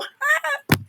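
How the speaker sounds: a warm sympathetic chuckle; kind gentle laugh. Real, not acted. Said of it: "I'm laughing with you".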